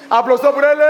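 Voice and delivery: high, loud call to clap; rally the class to cheer her; calling for applause. Real, not acted. Teacher calls for applause.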